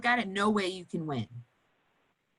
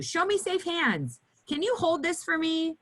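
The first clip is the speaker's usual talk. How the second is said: bright redirect; 9 semitones higher than usual. Shown as a demonstration. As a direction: give the kid a cheerful new task. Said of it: "I always recommend".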